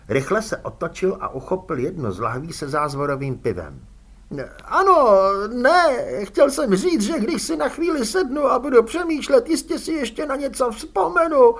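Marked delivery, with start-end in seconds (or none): 4.6-11.5 high, loud desperate begging; panicked pleading. A narrator's reading. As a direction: beg for mercy, terrified. Fredka backpedals in fright.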